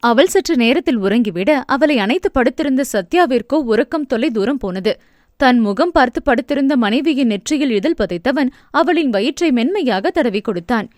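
plain reading voice

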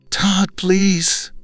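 high pleading whimper. A narrator's reading. Wife begs her husband not to leave, high whimper. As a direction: beg him, thin and high. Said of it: "Shelley whimpered".

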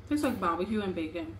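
eating a snack; chewing while talking. Real, not acted. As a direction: mouth partly full. Seen 1: Woman's hand at mouth eating chip, chewing while speaking.

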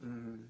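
low, soft held "mm"; M sound demo. Shown as a demonstration. Teacher demonstrating the letter M, M sound demo. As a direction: hum "mm" on its own, softly. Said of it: "So you would say".

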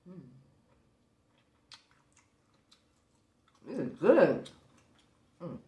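talking while chewing food; muffled through a mouthful; mouth full, chewing. Real, not acted. Seen 0: Bites bun at -7; cheeks and jaw show chewing while speaking.

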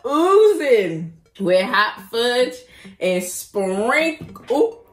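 loud, slow, giggling read; slow laughing gush. Real, not acted. Laughing while reading description.

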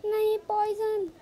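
high, loud cry of alarm; dismayed shout. Real, not acted. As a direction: cry out as poison hits you. Gets poisoned in game.

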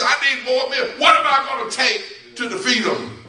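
high, loud, urgent pleading; agitated protest. Acted. Moses arguing he cannot defeat Pharaoh, agitated.

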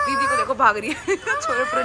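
high, squealing laugh-cry; excited squeal. Real, not acted. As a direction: squeal with delight as she runs off. Laughing at sister running.

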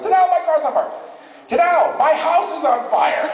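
high-pitched frantic cry; mock panic, voice way up high; panicked phone call. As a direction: act out an alarmed friend calling for help. Friends phoning in panic about fires.

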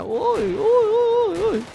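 high excited squeals of ui; squealing ui. Real, not acted. Gamer squealing as the helicopter takes off. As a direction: squeal as the chopper lifts.

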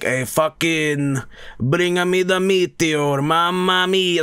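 high, drawn-out cartoon Italian; Italian-accented pleading to the heavens; mock Italian accent. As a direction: stretch it out in a hammy Italian accent. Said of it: "That's not how he talks".

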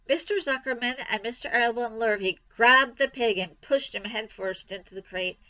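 ordinary delivery

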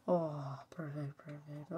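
normal talking voice

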